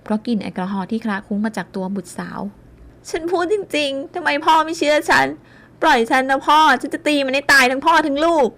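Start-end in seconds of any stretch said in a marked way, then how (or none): 2.7-8.5 high, loud raging plea; frantic drunk rage. A narrator's reading. Drunk daughter struggling in her father's grip, raging. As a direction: fight to break free.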